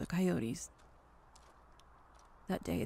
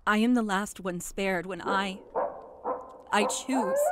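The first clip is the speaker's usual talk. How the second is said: building intensity; 5 semitones higher, 12 dB louder than usual. Acted. Climax builds louder.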